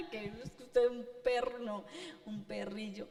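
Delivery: amused, laughing through the words. Real, not acted. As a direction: laugh as you retell the insult. Laughs while retelling the insult. (entre risas)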